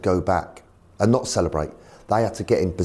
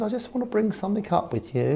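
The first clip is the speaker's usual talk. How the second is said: posh chairman; 13 semitones higher than usual. Retold or imitated. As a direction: put on a plummy chairman voice. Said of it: "He's very posh".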